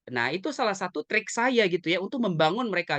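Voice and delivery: ordinary delivery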